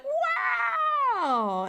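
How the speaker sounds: long, very high mock-amazed wow; exaggerated awe. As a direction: stretch it out in hammed-up wonder. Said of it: "we'd be all like".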